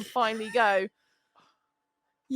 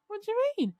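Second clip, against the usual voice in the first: incredulous question; 9 semitones higher, 3 dB quieter than usual. Retold or imitated. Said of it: "I was like".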